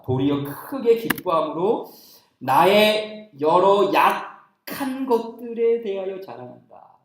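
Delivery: slow, drawn-out recitation; scripture reading. Real, not acted. Preacher reading out Paul's boast in weakness, reciting. Reads the verse aloud.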